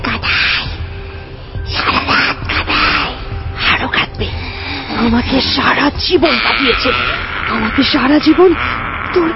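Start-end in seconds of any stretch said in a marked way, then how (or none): 0.1-4.3 harsh, voiceless gloating whisper; menacing witchy whisper; sinister gloating. Acted. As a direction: relish the child's suffering under your breath.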